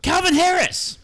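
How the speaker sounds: high, loud shouted name. Acted. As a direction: yell the name out. Shouted running gag.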